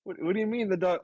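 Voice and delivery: high, stunned question; can't believe what you heard; shocked disbelief. Retold or imitated. Shocked at dog news.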